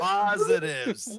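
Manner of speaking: high, loud, slow yelp; excited cry. Real, not acted. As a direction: yelp in disbelief. Shouting at big play.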